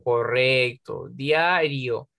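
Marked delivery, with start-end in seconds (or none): 1.1-2.1 "Diario" as two beats, dia-rio; split into syllables. Shown as a demonstration. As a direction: keep I and O together in "Diario". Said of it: "tampoco la puedo separar".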